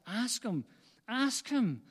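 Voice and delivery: rousing, urgent exhortation; urgent urging. Retold or imitated. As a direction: press it harder each time, pitch climbing. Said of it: "There's that urgency in his, in his spirit".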